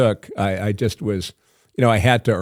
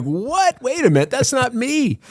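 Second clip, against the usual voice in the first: mock shock. Shocked at his dubbed voice.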